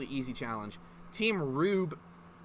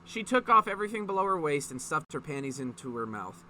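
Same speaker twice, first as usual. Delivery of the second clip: ordinary delivery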